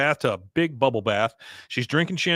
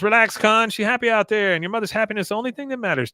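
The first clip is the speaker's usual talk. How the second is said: dismissive wife; 8 semitones higher, 4 dB louder, faster than usual. Retold or imitated. Min dismisses Con's worry.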